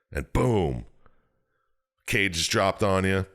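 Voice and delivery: usual speaking voice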